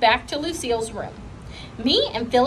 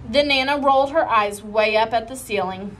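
ordinary delivery, nothing marked

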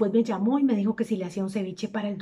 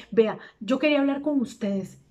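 usual speaking voice

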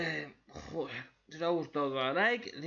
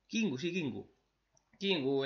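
usual speaking voice

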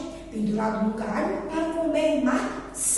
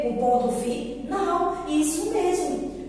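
normal talking voice

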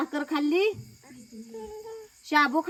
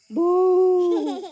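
playful boo; 3 semitones higher, 5 dB louder than usual. Pretend. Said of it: "भाऊ कर बरं".

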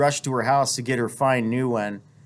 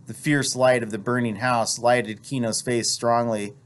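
ordinary delivery

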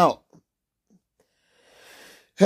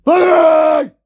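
loud, much higher curse; furious shout; 9 semitones higher, 7 dB louder than usual. Real, not acted. Streamer losing it on stream, furious shout. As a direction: explode on the word. Sudden outburst.

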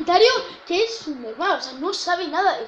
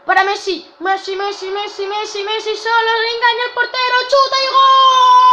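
rising, faster and louder play-by-play; breakneck goal commentary; 6 semitones higher, 6 dB louder than usual. Acted. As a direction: build to a shouted goal call. Commentating his own attack.

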